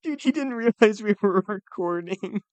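high, put-on upset voice; high prank-call whine of disbelief; feigned distress. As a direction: plead in fake dismay. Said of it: "to really sell it up".

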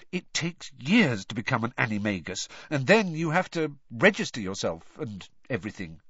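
ordinary delivery, nothing marked

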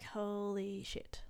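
slow, hushed disbelief; drawn out under the breath; quietly overwhelmed, retold; awed nerves. Nervous before the wedding, retold.

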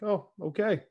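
high-pitched, mock-compliant reply; meek dad. Imitating dad accepting mom's rules, meek high voice.

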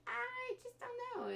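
very high, fretful worried voice; anxious girlfriend. Retold or imitated. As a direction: voice her anxious and small. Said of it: "she's like".

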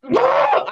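high excited yelp; excited shriek. Real, not acted. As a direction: squeal with sudden delight. Excited recognition, high shout.

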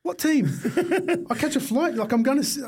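put-on a stunned teen, half laughing; shocked disbelief. Teen's shocked reply to boss.

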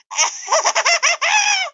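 loud, high cackle; high shrieking laugh. Acted. The imagined Chip laugh, shrieked out loud. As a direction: let out a wild, shrieking laugh. Wild laugh, friend asks what.

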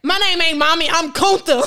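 a mom telling her daughter her new name, mock-proud; defiant mock declaration. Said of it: "I say".

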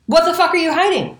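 a furious rhetorical challenge; outraged. Real, not acted. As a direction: confront them as if they stood there. Outraged at the owners.

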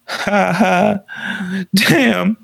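high, laughing through the words; laughing disbelief. Retold or imitated. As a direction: crack up while repeating the gossip. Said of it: "immediately started laughing and was like".

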